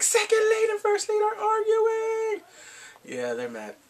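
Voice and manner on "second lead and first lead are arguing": high, stretched-out squeal of drama; gleefully scandalised exclamation; excited outcry. Real, not acted. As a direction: announce the fight in a high wail. Hypes the rivals' argument.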